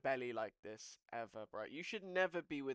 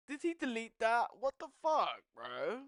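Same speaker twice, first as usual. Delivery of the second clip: high, loud, exasperated; can't believe he deleted it; outraged disbelief; 9 semitones higher, 8 dB louder than usual. Real, not acted. Yelling at the chat about Zerka, high loud outrage.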